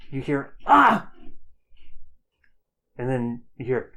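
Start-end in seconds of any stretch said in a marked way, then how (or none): 0.7-1.1 a high, loud cry of pain. Acted. Hero cries out when struck by attackers, loud cry. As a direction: shout out as if struck.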